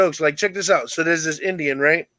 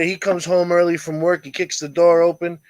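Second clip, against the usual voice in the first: normal talking voice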